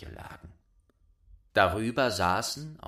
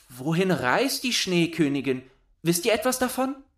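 put-on much higher, louder girl voice; Gerda calling up; 8 semitones higher, 6 dB louder than usual. A narrator's reading. Gerda calling up to the doves, high eager voice. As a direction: call up to the birds.

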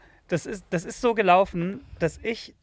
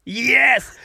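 excited; 6 semitones higher, 7 dB louder than usual. Real, not acted. Excited about "Boomerboot" joke.

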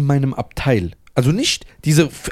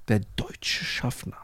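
slow, low, whispered; hushed build-up; 5 semitones lower, 13 dB quieter, slower than usual. Real, not acted. Teller building suspense for friends, whispered.

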